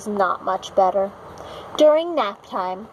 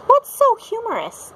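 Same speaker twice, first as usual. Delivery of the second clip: teacher voice; 12 semitones higher, 4 dB louder than usual. A narrator's reading. Teacher asks the class.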